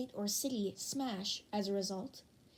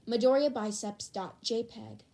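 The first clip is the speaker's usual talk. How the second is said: usual speaking voice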